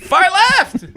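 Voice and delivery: loud, high mock shout; mock yell. Retelling the misheard Costco yell, loud mock shout.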